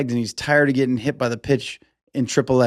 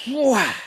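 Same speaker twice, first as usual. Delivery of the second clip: high, drawn-out bat-throw noise; whoosh-whack; 10 semitones higher than usual. Retold or imitated. Showing the bat flying at pitcher, imitated whoosh. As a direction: imitate a bat flung hard.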